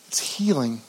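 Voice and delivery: hushed, breathy "it's healing"; whispered. Real, not acted. Preacher leaning in to the congregation, whispered. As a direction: drop to a whisper for the point. Preacher softens on key point.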